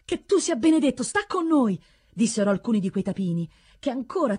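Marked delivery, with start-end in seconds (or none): 0.0-1.8 high-pitched, fervent blessing; thankful peasants' appeal; grateful plea. A narrator's reading. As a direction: implore your rescuer in a raised pitch. Peasants bless their rescuer.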